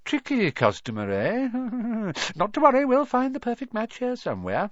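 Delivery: put-on high-pitched, chuckling old wandmaker; excitable, delighted shopkeeper; gleeful. A narrator's reading. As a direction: reassure with rising delight and a chuckle. Wandmaker happier with each failure.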